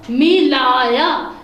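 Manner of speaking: slow, drawn-out मिलाया with each syllable held; slow model reading. Shown as a demonstration. Teacher models the word मिलाया slowly for students. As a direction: read मिलाया slowly for the class. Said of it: "ये अलिफ या".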